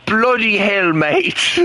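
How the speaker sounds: mock British bloody hell mate; a cheeky fake British curse; British accent. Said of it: "British language".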